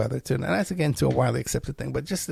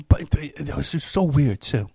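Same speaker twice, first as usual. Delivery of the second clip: ordinary talk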